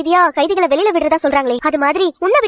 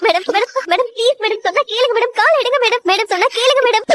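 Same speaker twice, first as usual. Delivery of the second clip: higher, frantic repeated begging; desperate pleading; 5 semitones higher than usual. Acted. Prisoner begging warden to lift her foot, frantic. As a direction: plead urgently over and over. Prisoner begs as doll is trampled.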